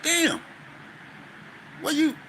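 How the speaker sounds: high-pitched disbelieving outburst; retold surprise. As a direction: caught off guard by the quick return. Friend returns too fast.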